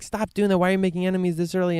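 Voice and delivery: high, exasperated imitation of scolding; mock scolding. Voicing own earlier scolding of candidate.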